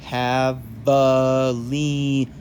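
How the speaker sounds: Javelinas drawn out very slowly, h sound at the start; a slow teaching say of Javelinas; pronunciation demo. Shown as a demonstration. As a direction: spell out Javelinas slowly, HAH-veh-LEE-nahs. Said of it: "we have the pronunciation again".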